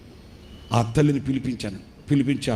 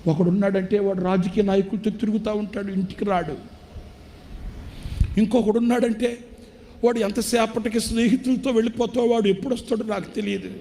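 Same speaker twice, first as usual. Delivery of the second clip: high, pleading, close to tears; tearful plea; 10 semitones higher than usual. Retold or imitated. Preacher voicing the weeping old father, high and tearful. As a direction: cry out the sons' neglect as the old father. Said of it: "ఆ తండ్రి ఏడుస్తూ అంటాడు".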